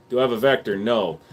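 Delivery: usual speaking voice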